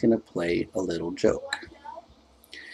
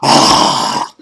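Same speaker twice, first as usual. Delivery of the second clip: loud mock scream of outrage; hammed-up angry outburst; exasperated scream; 9 dB louder than usual. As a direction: imitate her fed-up "aah". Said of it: "she gets all mad and pissed off".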